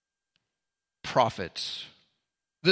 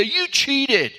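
accusing; 11 semitones higher than usual. Retold or imitated. Imagined accusation quote.

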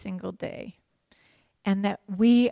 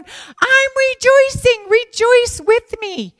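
high, loud, slow cry of joy; ecstatic, drawn-out proclamation; jubilant shout; 13 semitones higher, 10 dB louder, slower than usual. Acted. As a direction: shout it out from the prison cell. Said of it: "That's how Paul felt".

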